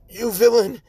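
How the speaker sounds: high, loud heroic accusation in an odd voice; righteous fury at the villain; outraged Ida. Acted. Ida shoved into wall, outraged.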